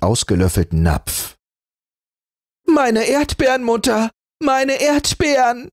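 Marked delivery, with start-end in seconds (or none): 2.7-3.6 much higher wailing fury; a spoiled child's furious wail; crying with rage. A narrator's reading. Sister discovering her eaten strawberries, raging wail. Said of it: "rief sie weinend vor Wut". 3.6-5.7 crying with rage. A narrator's reading. Sister wailing to her mother about strawberries, furious. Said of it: "rief sie weinend vor Wut".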